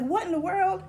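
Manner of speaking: high, loud retold astonishment; amazed reaction. Reader retelling first sight of a dictionary word, amazed.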